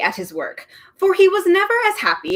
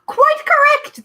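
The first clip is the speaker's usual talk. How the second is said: very high, loud triumphant shriek; 6 dB louder, slower than usual. A narrator's reading. The Trivium revealing himself, triumphant shriek. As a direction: screech it in triumph. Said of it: "the man shrieked triumphantly".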